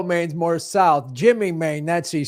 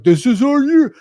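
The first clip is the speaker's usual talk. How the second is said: mock hyped fan; 8 semitones higher, 6 dB louder than usual. Quoting hyped Bears fans.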